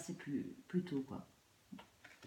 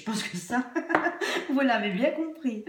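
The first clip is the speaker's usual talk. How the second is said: higher, louder laughing through words; 7 semitones higher, 8 dB louder than usual. Real, not acted. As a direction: laugh while you say it. Laughs while speaking.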